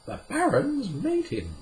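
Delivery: ordinary delivery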